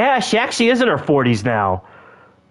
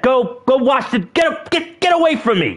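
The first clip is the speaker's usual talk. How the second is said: higher, stammering dismissal; upset; 6 semitones higher than usual. Pretend. Sending the sibling off to wash up, upset. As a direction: stumble over it, pitching up to push someone away. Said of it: "I'm just upset".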